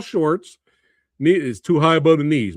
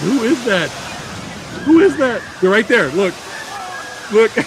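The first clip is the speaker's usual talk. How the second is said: excited; 7 semitones higher, 3 dB louder than usual. Real, not acted. Spotting friends on screen.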